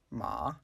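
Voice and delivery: MA gliding upward; rising tone. Shown as a demonstration. Teacher shows learners the second tone, "ma" rising. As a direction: let "ma" rise in pitch to the end. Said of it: "The second is a rising tone".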